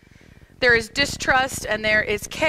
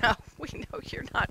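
fast, whispered brush-off; dismissive villagers; 8 dB quieter, faster than usual. Retold or imitated. Villagers brush off the boy, dismissive whisper. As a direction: wave him away under the breath. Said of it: "And the people are like".

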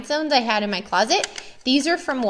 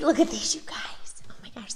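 hushed, delighted whisper over the crafts; awed whisper; 7 dB quieter than usual. Real, not acted. Teacher showing viewers her class's crowns, awed whisper.

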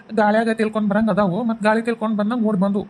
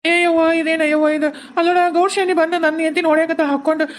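alarmed cry; 6 semitones higher than usual. Acted. Husband cries out at the fight.

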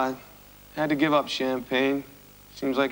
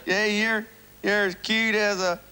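put-on cornball country accent; 7 semitones higher than usual. Guest woos the host in a cornball country accent. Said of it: "you're doing this cornball accent for".

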